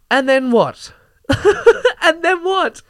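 high, loud incredulous question; and then what, pitched high in disbelief; exasperated. Real, not acted. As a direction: demand an answer, disbelieving.

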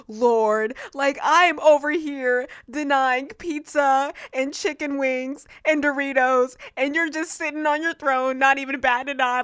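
high, loud, exasperated rant at God; mock outrage at God. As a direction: vent your frustration, hammed-up. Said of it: "I was so mad".

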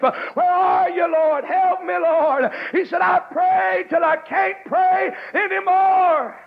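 high, drawn-out plea to God; anguished preached cry. Acted. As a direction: cry out to the Lord, stretching the words. Preacher voices David's desperate prayer.

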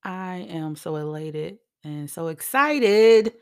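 elated show opener with drawn-out words; excited, getting loud. Real, not acted. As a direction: greet listeners with real, stretched-out excitement. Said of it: "sorry for getting loud".

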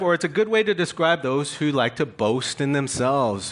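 ordinary talk

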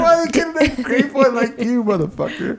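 high, laughing fake indignation; half-laughing, mock offended; cracking up at a friend over his grape drink; laughing mock outrage. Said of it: "I'm like".

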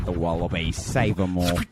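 low mock Aussie aside; mock Australian accent. Co-host extending the kangaroo joke, low mock Australian. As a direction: add it in a low put-on Australian voice.

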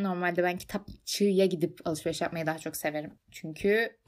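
ordinary talk, nothing marked

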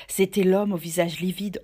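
ordinary delivery, nothing marked

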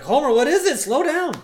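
high, loud Marge voice; mock Marge. Marge tries to calm Homer down, high and loud.

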